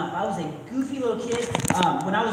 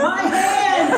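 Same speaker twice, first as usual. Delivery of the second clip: loud mock wail of my hand; spooky yell; 9 semitones higher, 6 dB louder than usual. Son scaring dad from back seat, loud mock wail. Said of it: "I scare him even more by going".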